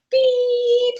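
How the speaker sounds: long, high, steady monotone beep; flatline beep. Retold or imitated. Coach shows a business with no heartbeat, flatline beep. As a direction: imitate a heart monitor flatlining. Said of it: "It is flatline".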